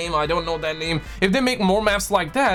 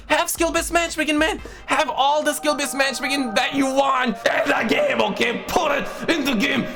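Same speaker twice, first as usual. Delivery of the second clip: emphatic rant; 6 semitones higher than usual. Real, not acted. Ranting demand.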